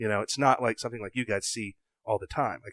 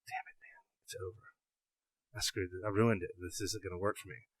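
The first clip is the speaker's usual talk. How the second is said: whispered, softer, defeated; despairing whisper; 4 semitones lower, 13 dB quieter than usual. Retold or imitated. Hunter sure he ruined the shot, whispering despair. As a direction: breathe it out like all hope is gone.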